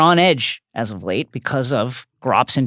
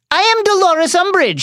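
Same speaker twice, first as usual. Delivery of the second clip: much higher, a mock frightened squeak; high-pitched terrified; 4 dB louder than usual. A narrator's reading. Umbridge introducing herself to the centaurs, high and scared. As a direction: squeak it out, trying to sound important. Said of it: "in a high-pitched, terrified voice".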